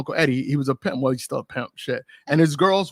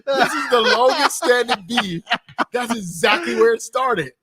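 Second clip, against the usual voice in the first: excited; 8 semitones higher, slower than usual. Real, not acted. Laughing surprise recalling beef.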